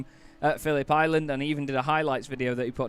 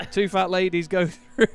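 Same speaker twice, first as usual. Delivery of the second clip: bingo caller; 4 semitones higher, 6 dB louder than usual. Pretend. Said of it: "Should be a bingo caller".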